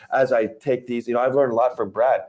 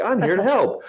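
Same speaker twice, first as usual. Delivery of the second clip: higher, salesy cheer; mock eager salesman; 8 semitones higher than usual. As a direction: sell it too hard.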